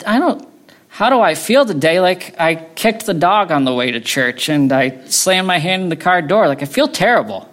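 imitated exasperated reply, pitched high; Alistair Begg. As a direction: protest in a high, worked-up voice, no accent. Acts out Begg's exasperated reply.

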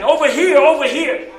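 high, loud barker call; calling from far away. Retold or imitated. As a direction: beckon passersby across the midway. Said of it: "phrases that they would use".

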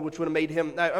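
ordinary delivery

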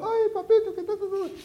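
very high cooing spoiling mother; baby the screaming child in a high coo; doting mother. Retold or imitated. Mother in a shop cooing at her screaming child, very high. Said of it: "la madre estaba así".

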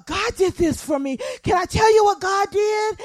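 high, loud, bursting with news; excited testimony. Acted. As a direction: shout it out, thrilled to tell what God did. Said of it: "told with such excitement".

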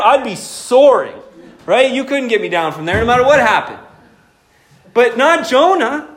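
ordinary talk, nothing marked

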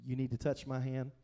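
tender offer to a doubting friend; loving invitation. Retold or imitated. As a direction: coax Thomas in close, tenderly. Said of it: "he comes lovingly and he says".

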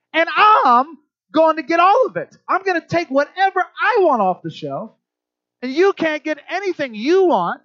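a hammed-up bossy parent, loud and high; mocking how parents talk to kids in the store. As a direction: lord it over the kid, loud and high. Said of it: "we say to them".

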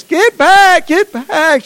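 high, loud frantic shout; mama shouting. Retold or imitated. Mother shouting at her boy to get away. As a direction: yell the warning like a panicked mother.

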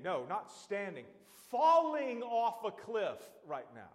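full-force preaching. Acted. Preacher warning a sinner at the cliff edge, loud and urgent. Preaching to someone steeped in sin.

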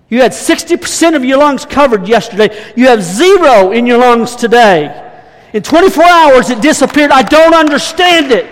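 doctor who can't make sense of it, getting worked up; baffled doctor. Retold or imitated. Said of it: "He said".

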